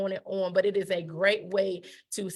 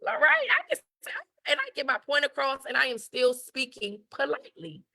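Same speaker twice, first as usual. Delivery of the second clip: put-on high, amazed girly voice; much higher, wide-eyed young girl; excited teen girl; 6 semitones higher than usual. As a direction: play a thrilled teenager discovering new words.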